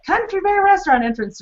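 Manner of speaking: high, lilting take on the sign; sing-song variation. Shown as a demonstration. Showing listeners another way to read the sign, high and lilting. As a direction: play with the pitch of the phrase. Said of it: "say that sign in so many different ways".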